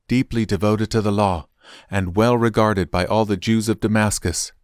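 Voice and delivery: plain reading voice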